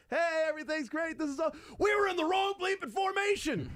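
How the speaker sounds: very high, hyper-cheerful coach voice; a giddy, squeaky coach who loves everything; high-pitched overjoyed play caller; Matt Nagy impression. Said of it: "[name]'s Matt Nagy impression".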